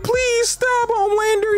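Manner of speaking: high mock-tearful little girl begging; little girl crying. Narrator imitates little girls begging Homelander, high and tearful. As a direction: squeak it out like a scared little girl pleading. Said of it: "little girls crying like".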